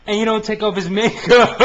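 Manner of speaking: high, loud, breaking into laughter; laughing incredulously. Real, not acted. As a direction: crack up at the absurdity as you ask. Cracks up at absurd arrest.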